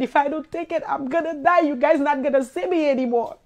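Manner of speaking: ordinary delivery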